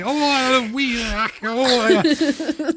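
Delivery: high-pitched nonsense Scottish wail; mock Scottish outburst. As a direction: burlesque an angry Scot with hardly any words. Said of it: "I'm not gonna do a Scottish accent".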